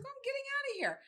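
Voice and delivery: high-pitched retold defiance; lightly acted stubborn mom; determined runaway mom. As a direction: announce it high, set on leaving. Runaway mother voiced in story.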